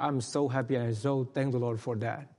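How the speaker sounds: ordinary delivery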